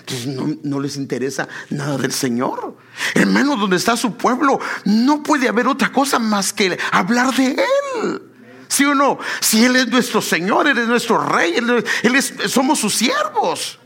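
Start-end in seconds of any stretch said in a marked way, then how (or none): 3.1-13.8 high, loud fervent preaching, calling out to the congregation; preaching at full force. Acted. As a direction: lift the church with a ringing high cry. Preaching climax about the Lord. (predicando a todo pulmón, fuerte y agudo)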